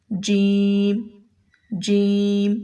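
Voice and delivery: جیم said clearly with the tongue touching the palate; letter jeem. Shown as a demonstration. Teacher modelling the letter جیم for students. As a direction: model جیم slowly and distinctly. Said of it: "ویسے ہی یہ ادا ہوگا".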